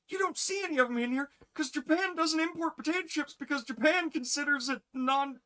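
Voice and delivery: high, mock-indignant ranting; retold exasperated rant. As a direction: rant high, ending in a loud outburst. Retells friend losing it over chips.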